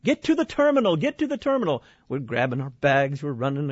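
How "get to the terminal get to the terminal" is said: high, urgent mock order from the stewardess; stewardess shouting orders. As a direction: bark it like a panicked stewardess, pitched up. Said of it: "the stewardess is like".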